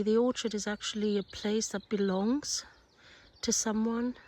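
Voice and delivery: ordinary talk, nothing marked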